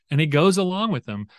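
ordinary delivery

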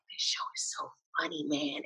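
hushed, laid-back stoner voice; chill stoner drawl. Retold or imitated. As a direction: say it like a mellow stoner, almost breathless. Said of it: "she's like".

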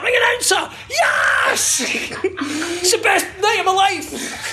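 put-on high shouted, slow excited kid. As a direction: squeal like a thrilled child. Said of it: "little kids can get".

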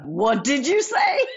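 slow, rising disbelief from the father; a hint of a stunned dad demanding a repeat; shocked dad. Dad's shocked reply retold.

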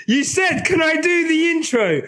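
high, sharp mock scolding; loud sharp voice. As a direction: scold the host loudly, half joking. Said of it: "Why are you shouting?".

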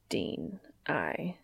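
halting whispered start. Acted. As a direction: whisper it and stall.

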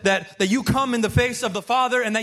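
begging Jesus, building from earnest to near-crying high; desperate plea. Retold or imitated. Mother pleading with Jesus.

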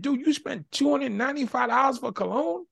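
high, disbelieving question; stunned at the price; dad questioning the cologne purchase; incredulous. Retold or imitated. Dad confronts son over cologne.

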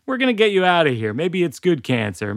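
fast, high, bubbly mom; hurried chirpy reassurance; cheery mom. Retold or imitated. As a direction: rush in to cheer him up. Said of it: "my mom is like".

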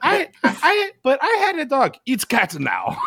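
high-pitched put-on Eastern European accent. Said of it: "Also, perfect accent".

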